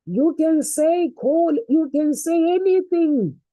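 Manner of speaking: pushing the crowd to speak up boldly; fired up. Real, not acted. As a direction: preach it with rising fire. Preacher's rising exhortation.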